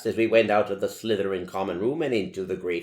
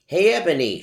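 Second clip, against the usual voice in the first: loud, higher call across the yard; shouting; 11 semitones higher, 10 dB louder, faster than usual. Acted. Draco calling across to Ebony, a loud shout. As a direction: call her name out loud. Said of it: "shouted a voice".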